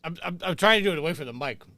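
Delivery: crunching toast mid-sentence; chewing while talking. Real, not acted. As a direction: talk through a mouthful of toast. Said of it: "I can hear you crunch on that Texas toast".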